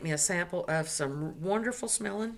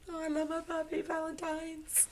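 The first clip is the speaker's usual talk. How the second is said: cooing; 10 semitones higher, 7 dB quieter than usual. Real, not acted. Cooing over puppy card.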